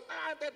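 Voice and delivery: high imitated car horn tet tet; horn beeps. Mimicking the car honking at him.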